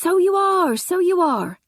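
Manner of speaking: amiable sleepy repetition; dozy constable. Acted. Tall young constable greets the maid, dozy. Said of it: "said the tall young constable".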